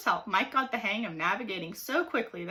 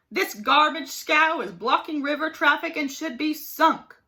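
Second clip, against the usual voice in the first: higher, louder holler of a bullying captain; 5 semitones higher, 5 dB louder than usual. A narrator's reading. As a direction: yell it down at the keelboat. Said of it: "hollered Blathersby".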